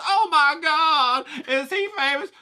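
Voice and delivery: high, loud, slow gasp; excited Karen. Retold or imitated. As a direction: squeal with starstruck awe. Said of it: "she goes".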